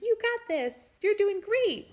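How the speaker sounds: high, bright cheering; cheerful encourager. Retold or imitated. Nurse cheering a laboring mother, high and loud. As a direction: cheer her on like a peppy coach. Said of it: "someone who's like".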